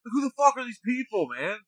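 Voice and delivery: bewildered fury at a room of strangers; imitating his own shocked outburst on coming back; outraged disbelief. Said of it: "I'm like".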